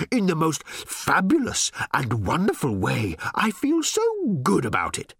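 high, swooning, overjoyed robot; gushing robot. A narrator's reading. Little robot swooning with delight at Ford. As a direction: swoon over every word.

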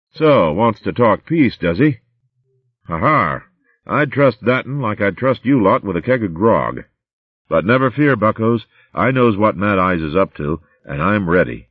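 rough rolling pirate voice, gloating; gruff pirate captain. Acted. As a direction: sneer it like a cocky corsair. Pirate leader to his captains.

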